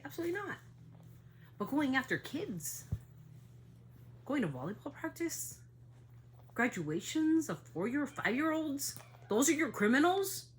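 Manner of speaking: rising indignant outcry. Real, not acted. Indignant rant about kids.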